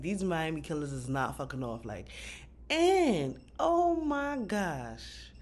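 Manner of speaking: ordinary talk, nothing marked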